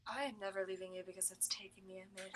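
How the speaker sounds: hushed and hurried promise; quiet rushed reassurance; soft quick murmur. Acted. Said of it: "She goes".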